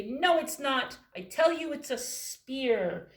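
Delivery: loud, high insisting; loud stubborn mouse; arguing mouse. Acted. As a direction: shout your claim over them. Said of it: "mouse say".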